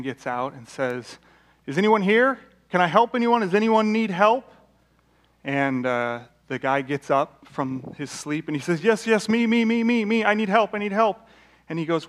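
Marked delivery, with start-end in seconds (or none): 1.7-4.5 loud, high rescuer's call. Acted. Rescuer calling out on shore. 8.8-11.2 high, loud, urgent pleading; frantic castaway calling for help; desperate eager reply. Acted. Stranded man answering his rescuer.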